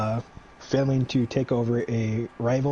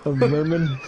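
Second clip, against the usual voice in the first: laughing, a bit louder; 3 semitones higher, 5 dB louder than usual. Real, not acted. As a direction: crack up on the word. Laughing repeat of the reveal.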